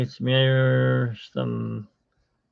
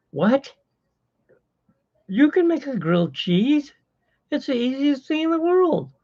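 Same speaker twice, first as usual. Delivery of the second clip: mock squeaky disbelief, much higher; astonished high voice; 13 semitones higher than usual. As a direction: squeal it in fake amazement. Acted exchange over grilled cheese.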